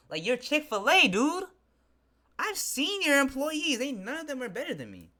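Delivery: high, loud incredulous outburst; scandalized, high-pitched venting; indignant disbelief. Real, not acted. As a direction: protest way up high in your voice. Indignant at the rejection.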